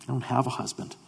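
quiet woman's answer. Acted. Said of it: "The woman answers and says".

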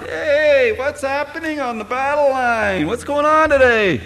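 high, carefree mock voice of a lounging priest; lazy Eli. Eli lounging outside the temple, carefree high mock voice. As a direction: toss it off like a man tipped back in his chair.

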